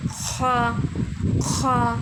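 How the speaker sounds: خا rasped from the throat; Arabic kha. Shown as a demonstration. Teacher demonstrates the throaty خا to students. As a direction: scrape خا from the back of the throat. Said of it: "یہاں سے ہمارا جو ہے ادا ہوتا ہے".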